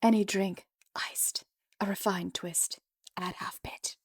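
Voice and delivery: breathy whispered menu read; playful ASMR-style whisper. Pretend. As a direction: hush every word like a secret.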